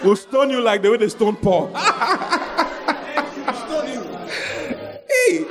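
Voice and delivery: high laughing line that breaks into laughter; amused, laughing delivery. Real, not acted. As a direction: laugh it out with a rising voice. Laughing about stoning in Ghana.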